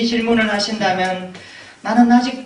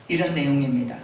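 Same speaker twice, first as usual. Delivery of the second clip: ordinary talk, nothing marked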